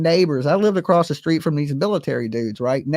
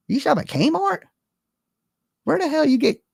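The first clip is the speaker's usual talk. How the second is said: mocking disbelief; 10 semitones higher than usual. Mocking Joe's clothes.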